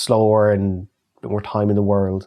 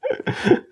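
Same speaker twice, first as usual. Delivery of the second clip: high laugh. Real, not acted. Laughing at his own story.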